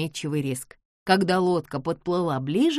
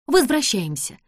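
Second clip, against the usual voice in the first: urgent call; 7 semitones higher, 4 dB louder than usual. Acted. Fleeing the cave.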